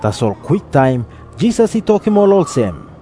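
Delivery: usual speaking voice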